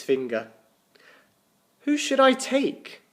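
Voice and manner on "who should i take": usual speaking voice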